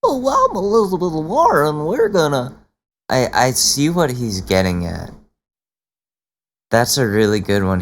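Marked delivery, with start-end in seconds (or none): 0.0-2.6 high, mocking politician impression; exaggerated high send-up voice; Elizabeth Warren. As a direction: pitch it way up in mockery. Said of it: "that's how she sounds".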